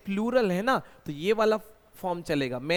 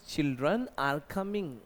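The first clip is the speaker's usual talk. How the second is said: very slow model phrase; slow dictation; much slower than usual. Shown as a demonstration. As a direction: let each word land. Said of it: "बच्चे आ रहे हैं". (धीमा उदाहरण वाक्य)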